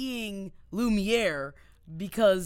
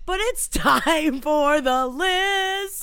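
loud, high, drawn-out show intro; hammed-up game-show style announcement; segment announcer; 7 semitones higher, 7 dB louder, much slower than usual. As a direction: announce it slowly with big fanfare. Announces the podcast's list segment.

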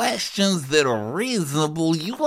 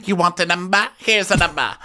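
ordinary delivery

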